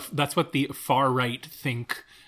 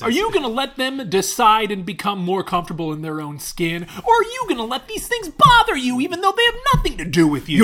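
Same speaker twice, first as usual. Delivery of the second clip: mock rally speech; 7 semitones higher, 7 dB louder than usual. Parodic counter-rant to pundit.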